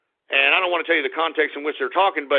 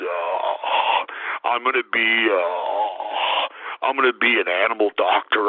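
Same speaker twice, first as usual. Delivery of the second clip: much lower, slow, whiny dopey mope; mocking Eeyore voice; 10 semitones lower, slower than usual. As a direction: drag each word out like a pathetic, weepy dimwit. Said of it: "It's, it's Eeyore".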